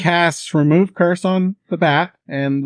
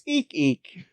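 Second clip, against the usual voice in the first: mock bat squeak, eek eek; 5 semitones higher, 6 dB quieter than usual. The druid speaks bat to Kira, a mock squeaky eek eek. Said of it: "speaking in bat".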